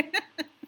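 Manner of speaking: short, soft laugh; amused chuckle. Real, not acted. Teacher teasing students that they will forget, soft chuckle. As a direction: chuckle quietly to yourself.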